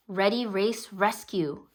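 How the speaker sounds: ordinary delivery, nothing marked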